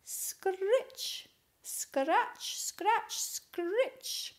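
high, rising scritch scratch; scratchy hoe sounds in a storybook voice; hoe scratching noise. A narrator's reading. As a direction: imitate a hoe scraping the soil. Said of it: "he heard the noise of a hoe".

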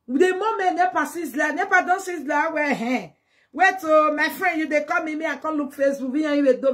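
lively mock panic. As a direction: act flustered in the dark. Acts out blackout panic scene.

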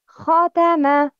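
ختم said slowly, each sound clear with a strong kh; slow model word. Shown as a demonstration. Teacher to class, modelling a word to repeat, slow and clear. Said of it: "Please repeat after me".

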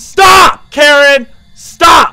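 high, loud shouts at the ghost; yelling. Real, not acted. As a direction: yell stop with real panic. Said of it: "yelling at the ghost".

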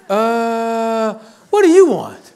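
high, loud exasperated question; mock a fed-up barista pushing for an order; impatient barista. Barista to dithering students in line, exasperated.

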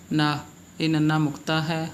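ordinary talk, nothing marked

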